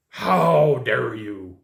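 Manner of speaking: drawn-out, very slow mock outrage; hammed-up huffy client voice; mock indignant client. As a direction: stretch it like an offended customer. Mocking an angry rejected client.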